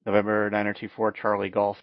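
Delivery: ordinary talk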